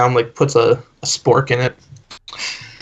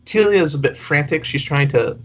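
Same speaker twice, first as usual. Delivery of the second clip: ordinary delivery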